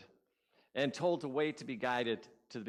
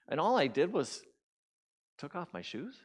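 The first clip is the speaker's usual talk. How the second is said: usual speaking voice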